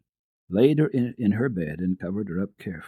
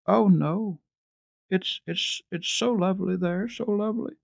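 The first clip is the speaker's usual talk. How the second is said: much higher, breaking with longing; girl near tears; 11 semitones higher than usual. A narrator's reading. Heidi near tears describing the mountain home, much higher. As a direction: plead about her beloved home.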